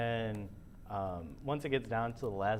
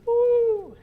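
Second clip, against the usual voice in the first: excited whoop; 14 dB louder than usual. Real, not acted. Cheers before his album pick.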